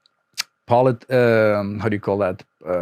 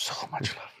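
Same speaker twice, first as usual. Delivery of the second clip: breathy whispered echo of so much love; 18 dB quieter, much faster than usual. Shown as a demonstration. Repeating so much love to her cohost, whispered.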